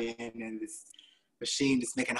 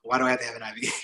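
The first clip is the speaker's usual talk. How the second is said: incredulous; 3 semitones higher, 7 dB louder than usual. Retold or imitated. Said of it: "But I said".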